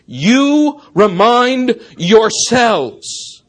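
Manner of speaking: slow, loud and deliberate, every word pressed; emphatic. Shown as a demonstration. Pastor insisting the church keep reminding themselves, slow emphatic stress. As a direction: drive it home word by word. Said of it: "makes this even more emphatic".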